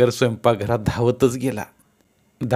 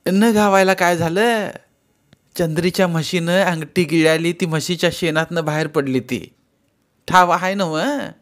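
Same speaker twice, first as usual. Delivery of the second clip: put-on teasing old mother; 7 semitones higher than usual. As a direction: rib him about finding gold. Mother's voice, gossiping.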